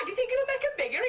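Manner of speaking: high, quick disbelieving question; mock incredulity. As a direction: imitate a wife querying a daft idea. Wife doubts husband's silly advice.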